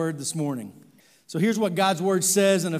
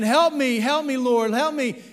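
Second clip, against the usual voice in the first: louder, higher frantic calls; mock scream for help; 7 semitones higher, 6 dB louder than usual. Hiker screaming to the Lord again, loud and frantic. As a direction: scream it up the cliff. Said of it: "the man screams out again".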